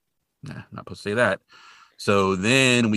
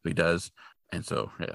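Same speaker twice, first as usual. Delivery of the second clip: normal talking voice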